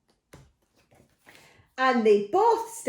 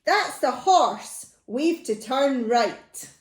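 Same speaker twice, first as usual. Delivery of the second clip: loud, slow, raised character line; voiced thief Len; 3 semitones higher, 4 dB louder, slower than usual. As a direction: announce it loudly and slowly as the fooled thief. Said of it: "And Len said".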